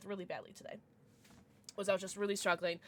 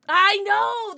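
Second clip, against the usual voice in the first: high, loud "I know!"; sudden excited exclamation; excited shout; 11 semitones higher, 13 dB louder than usual. Real, not acted. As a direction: blurt it out loud.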